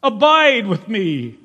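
sudden loud fervent prayer; fervent plea. Acted. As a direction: cry it out with longing.

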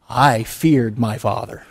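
ordinary talk

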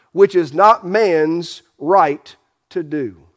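ordinary talk

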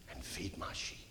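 hushed, breathy whisper to close. Acted. Jesus whispering his charge to Peter, hushed. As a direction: let the last line fall to a whisper.

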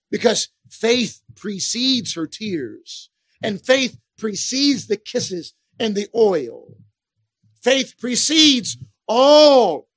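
slow down and hammer each word; slow emphatic. Real, not acted. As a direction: stretch it out very slowly, leaning on faith.

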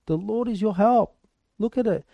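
ordinary delivery

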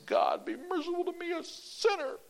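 very high, earnest plea; high trembling-free earnest plea; pleading prayer. Retold or imitated. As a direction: beg God for mercy as a dying man. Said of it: "him praying".

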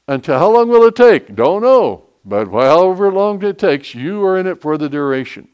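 full-force preaching. Acted. Pastor urging his flock to stay committed, shouting sermon. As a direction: thunder the question, then settle it.

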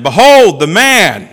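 high, slow, booming announcement; loud proclamation. Acted. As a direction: present him to a whole crowd. Pilate presents Jesus to crowd.

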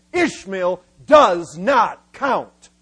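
slow, loud, each word of "does not count" separate; emphatic verdict. Acted. God ruling to Abraham, "does not count" hammered slowly. God's verdict voiced by preacher.

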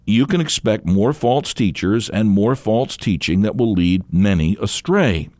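normal talking voice

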